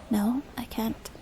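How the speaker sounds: usual speaking voice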